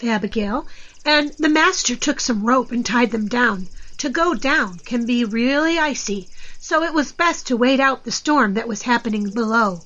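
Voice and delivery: usual speaking voice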